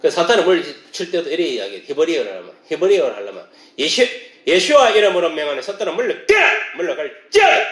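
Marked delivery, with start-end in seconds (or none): 3.8-7.7 exorcist's cry, jumping high and loud; commanding shout. Shown as a demonstration. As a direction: shout down Satan, building to a high yell. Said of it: "이렇게 딱 외쳐야 되는 거예요". (사탄을 쫓아내듯 높고 크게 외치는 명령)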